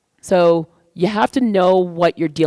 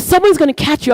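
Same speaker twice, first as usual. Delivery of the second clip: imitating worried mom; 8 semitones higher, 4 dB louder than usual. Mom warns, frustrated.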